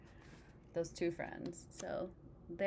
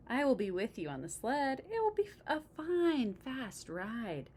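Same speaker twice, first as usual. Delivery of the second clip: excited reassurance; 6 semitones higher, 4 dB louder than usual. Acted. Frog reassuring Toad.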